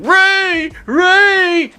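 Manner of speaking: high, loud mock scream of a name; hammed-up high-pitched yell. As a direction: imitate Finn yelling out desperately. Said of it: "Finn starts screaming".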